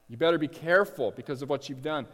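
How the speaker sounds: normal talking voice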